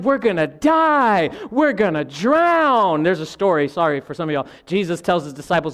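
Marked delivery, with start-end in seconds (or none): 0.0-3.0 loud, drawn-out hammed-up wail; crying out in panic. Disciples in the boat fear drowning, crying out in panic. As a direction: stretch out each last word in mock terror. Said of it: "and they're crying".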